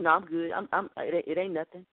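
low, fading husband's brush-off; mock male denial, dropped in pitch; evasive husband. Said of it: "Or you ask him".

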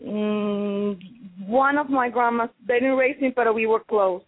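usual speaking voice

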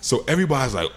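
ordinary talk, nothing marked

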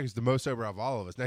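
ordinary talk

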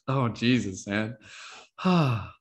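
ordinary talk, nothing marked

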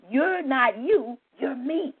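ordinary delivery

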